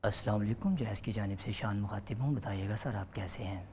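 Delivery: a hint of a smooth company caller; prank caller voice. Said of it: "تو اس کو ایسے کیا تھا". (مؤدب فون کال کرنے والے کی ہلکی سی نقل)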